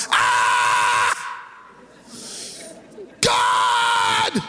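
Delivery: low, rough mock cry to God; hammed-up desperate yell to God. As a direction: act out a panicked shout for help. Mimics someone crying out in crisis.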